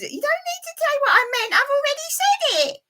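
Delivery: very high, squeaky puppet character lecturing. Acted. Puppet explains negative thinking to the viewers, very high squeaky voice. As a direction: pitch way up for the little puppet. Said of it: "it's a puppet show".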